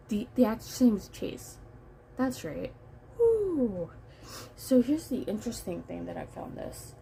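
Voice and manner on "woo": high, loud falling "woo"; relieved cheer. Real, not acted. Reviewer finally remembers the actor's name, relieved whoop. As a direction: let out a whoop of triumph.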